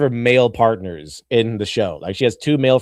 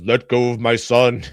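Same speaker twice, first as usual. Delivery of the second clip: Darth Vader; 2 semitones lower than usual. Retold or imitated. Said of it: "like Darth Vader coming in and goes".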